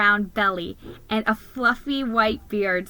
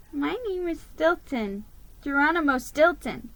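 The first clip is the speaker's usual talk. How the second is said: timid mouse voice; 6 semitones higher than usual. Acted. Mouse introducing himself to Mrs Claus, shyly polite. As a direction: introduce yourself meekly. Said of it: "I said".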